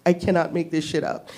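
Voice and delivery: ordinary talk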